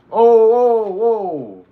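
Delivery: high, very loud startled cry; shocked yell. Real, not acted. Streamer startled by something in the game, shocked yell. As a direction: jump out of your skin.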